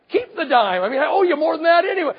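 loud, fast, high exasperated outburst; exasperated shout. Retold or imitated. Father shouting at honest old Edsel to keep the dime, loud and fast. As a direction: bark it out in disbelief.

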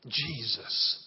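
breathed, voiceless name; whisper. Real, not acted. Pastor whispering the name to the congregation.

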